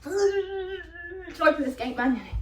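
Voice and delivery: a touch of whimpering effort; strained gate plea. Retold or imitated. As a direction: mimic the bald guy heaving at the gate. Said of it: "he's like".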